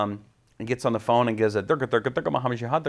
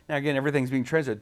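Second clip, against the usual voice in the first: usual speaking voice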